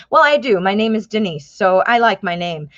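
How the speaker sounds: normal talking voice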